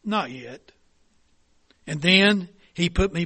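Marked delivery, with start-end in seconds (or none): 0.0-0.7 soft, low and gentle; quiet, unhurried reassurance; smiling gently. Acted. Potter gently answers the begging teacup, soft. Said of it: "He smiled gently and said".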